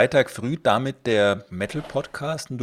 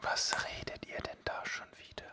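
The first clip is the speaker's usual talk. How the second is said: whisper; 16 dB quieter than usual. Real, not acted. Whispered aside.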